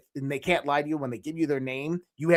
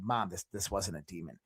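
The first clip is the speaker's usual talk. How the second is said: low insistence; 8 semitones lower, 10 dB quieter than usual. Retold or imitated. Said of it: "I said".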